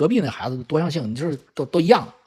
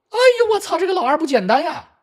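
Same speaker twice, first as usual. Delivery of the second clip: amazed realisation; 12 semitones higher than usual. Retold or imitated. Father discovers the second child's tricks, astonished. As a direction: marvel at the sly child, voice shooting up. 父亲突然发现老二的伎俩.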